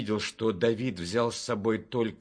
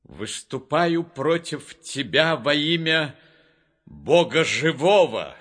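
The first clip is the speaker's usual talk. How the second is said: loud, ringing battle declaration; David's exclamation; 3 semitones higher, 8 dB louder than usual. A narrator's reading. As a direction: cry it out high and loud, building to the last words. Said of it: "Давид воскликнул".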